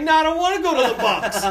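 put-on high, loud drunken wail; hammed-up whiny refusal; childish protest. Drunk self whines refusal.

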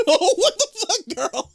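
very high, laughing outcry; laughing shock. Real, not acted. Host reacts to co-host bumping the mic, laughing shock. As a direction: squeal it through real laughter.

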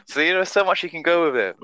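ordinary talk

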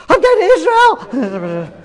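high, drawn-out mock scream of joy; excited shriek. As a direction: burst out squealing like a thrilled wife. Wife's excited scream acted again.

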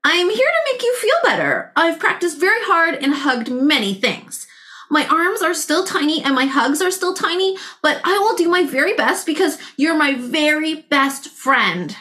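high, gentle reassuring little voice; tender character voice. Acted. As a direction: comfort a hurt friend sweetly. Tiny T-Rex comforts friend.

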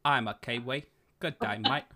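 Cockney-tinged attempt at a Kiwi accent; hammed-up Londoner failing at New Zealand accent; Michael Caine. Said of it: "Michael Caine trying to do a New Zealand".

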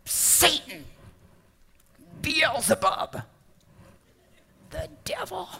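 mock cussing. Shown as a demonstration. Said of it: "No one ever cusses".

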